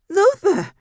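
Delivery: very high, loud desperate scream of his name; frantic cry. A narrator's reading. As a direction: shriek for her unmoving husband. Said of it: "no response from her husband".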